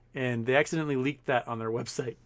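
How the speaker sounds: ordinary talk, nothing marked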